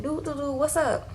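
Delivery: high, carefree strolling-in voice; mock carefree Michael. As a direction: play it like nothing happened, casual and high. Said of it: "just coming in the house like".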